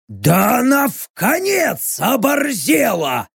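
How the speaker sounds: slow, high-pitched delighted bellow; gleeful roar. A narrator's reading. Fighter outraged at the prisoner's insult, gleeful roar. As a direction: roar it with relish, dragging the words. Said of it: "радостно проревел Рек".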